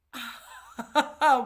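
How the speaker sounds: loud burst of nervous laughter; a real, loud nervous laugh. Real, not acted. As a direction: laugh out loud, a bit embarrassed. Laughs after admitting fear.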